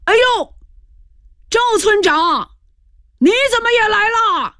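very high, delighted exclamation; surprised, happy shout. A narrator's reading. Squad leader spotting the village chief, surprised and happy. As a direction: cry out in amazed recognition. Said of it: "就惊奇起来， 高兴起来， 喊道".

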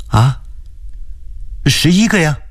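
young woman pleading on the phone, voiced high; anxious woman on the phone, pitched high; high female voice. A narrator's reading. Wenxin answers. (高声女声，焦急地问)

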